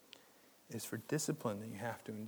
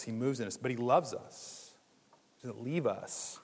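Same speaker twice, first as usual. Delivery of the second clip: normal talking voice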